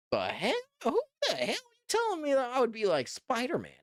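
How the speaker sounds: acted incredulous outburst, leaping up high; put-on shock, pitch shooting up on the second question; mock disbelief. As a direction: play at being stunned by an absurd suggestion. Imagined stunned reaction to friend's suggestion.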